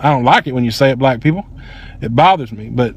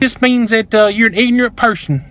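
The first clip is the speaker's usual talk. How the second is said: higher-pitched imitation of the woman; mocking excuse; 6 semitones higher, slower than usual. Woman explaining the word to customer, mocking imitation. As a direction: lightly mimic her explaining herself up high. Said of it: "Then she says other typical Southern bullshit".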